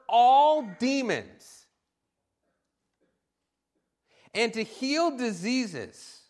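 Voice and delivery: slow, ringing preacher's reading of the verses; scripture reading. Acted. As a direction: read it out to stir them. Reads verses one and two.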